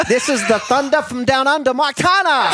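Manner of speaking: high, hammed-up Australian introduction; Australian accent. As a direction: announce him in a mock Australian accent. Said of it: "Australian's easier to do".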